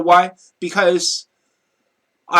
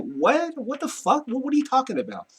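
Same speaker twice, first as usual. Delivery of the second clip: high-pitched, softish replay of bafflement; incredulous reaction; 8 semitones higher, 4 dB quieter than usual. Retold or imitated. Retells baffled reaction to brother's insult.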